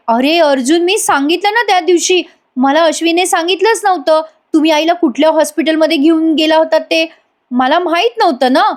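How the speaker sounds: high, whining self-defence; whiny excuse. Acted. Priya defending herself to Arjun, high whining excuse. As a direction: plead innocence in a high whine.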